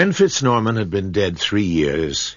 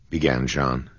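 ordinary delivery, nothing marked

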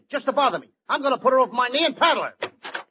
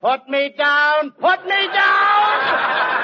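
slow yell; 3 semitones higher, much slower than usual. Acted. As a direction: wail to be put down. Tied up, yelling.

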